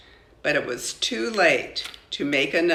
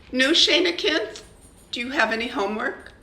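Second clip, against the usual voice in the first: mother voice; 8 semitones higher, faster than usual. A narrator's reading. Mama voiced in story.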